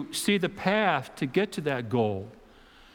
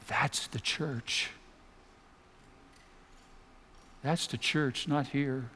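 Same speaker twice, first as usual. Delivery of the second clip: hushed; 7 dB quieter, slower than usual. Real, not acted. Hushed sermon point.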